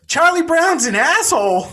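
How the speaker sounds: put-on very high, loud child voice, cheerfully rude; sweetly rude kid. A narrator's reading. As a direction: say it like a bratty little boy. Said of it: "just so lovingly goes".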